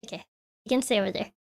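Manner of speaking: usual speaking voice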